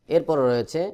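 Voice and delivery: ordinary talk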